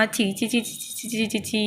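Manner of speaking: soft fading ছি ছি of disgust; quiet repulsed tsk-tsk; disgusted tutting. Acted. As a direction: recoil from the smell under your breath. Disgust at mess.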